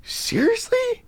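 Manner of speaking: high, slow incredulous question; can't believe what they heard; disbelief. Retold or imitated. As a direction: teammates stunned by the story. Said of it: "all the boys are like".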